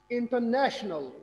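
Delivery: slow in-ter-NA-tional for international; stress on NA. Shown as a demonstration. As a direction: punch ने in international. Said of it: "stress किसको किया मैंने?".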